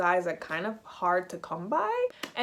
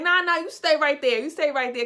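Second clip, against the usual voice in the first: raised, higher insistent objection; insisting; 6 semitones higher, 6 dB louder than usual. Retold or imitated. Pair telling his friend to stay, insistent. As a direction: stop someone leaving, loud and higher. Both stop the friend leaving.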